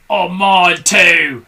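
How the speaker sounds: loud, slow grumpy shout; Mr Twit shouting. A narrator's reading. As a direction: bellow it back, outraged. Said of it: "shouted Mister Twit".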